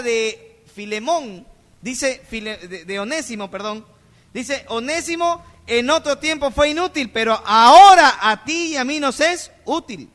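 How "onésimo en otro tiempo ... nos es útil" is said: preaching at full force. Acted. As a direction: proclaim it to the whole church. Quotes Paul, preaching force.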